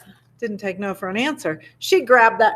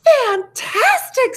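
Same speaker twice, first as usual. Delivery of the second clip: much higher, slow, drawn-out cry of delight; gushing praise; 13 semitones higher, much slower than usual. Acted. Princess cheering the dragon on, much higher and drawn out. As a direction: gush over it, stretch it out.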